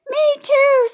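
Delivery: very high, loud chirp; eager little girl. A narrator's reading. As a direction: chime in brightly. Girl named Charlotte chimes in.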